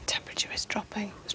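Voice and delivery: urgent hushed whisper. Real, not acted. Medium reports cold in a hush.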